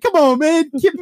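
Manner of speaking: high, loud imitated plea; urging retold shout. Sergeant urging the removal guy on, shouting. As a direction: egg him on, acting out the moment.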